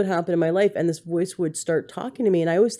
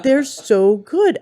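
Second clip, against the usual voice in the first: high, louder, drawn-out mock temptation; little voice; 8 semitones higher, 5 dB louder, much slower than usual. Imitating the craving voice selling her junk food, gushing. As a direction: gush slowly like the tempting voice in your head. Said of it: "this little voice goes".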